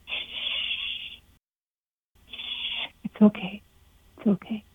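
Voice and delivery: quiet, tender shushing; imagined shepherd whispering comfort, softly. Retold or imitated. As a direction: hush gently, fading as it goes. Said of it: "my great shepherd whisper".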